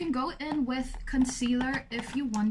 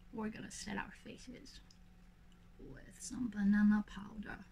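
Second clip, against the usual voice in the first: whispering; 6 dB quieter than usual. Real, not acted. Drops to a whisper.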